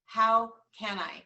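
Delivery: slow, loud and deliberate; slow emphatic question. Shown as a demonstration. Coach encouraging agents, slow and emphatic. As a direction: lean on each word. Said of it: "encourage you to ask yourself".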